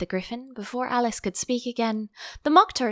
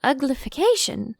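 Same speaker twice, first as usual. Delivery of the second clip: hesitant; 7 semitones higher, 3 dB louder than usual. Acted. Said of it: "Alice ventured to say".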